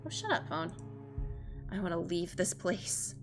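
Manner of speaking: normal talking voice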